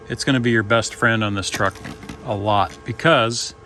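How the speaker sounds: normal talking voice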